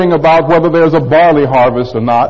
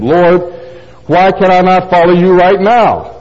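higher-pitched, insistent questioning; insistent protest; 3 semitones higher than usual. Acted. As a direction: protest like someone who won't wait. Acts Peter protesting to Jesus.

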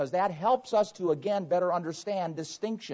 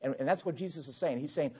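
normal talking voice

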